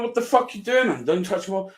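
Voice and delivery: outraged protest. Retold or imitated. Retold protest at the search.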